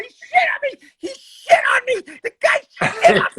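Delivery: high hysterical shriek, near crying; frantic high-pitched scream. Retold or imitated. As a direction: shriek it in horrified outrage, voice breaking into falsetto. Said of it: "then I start screaming and screaming".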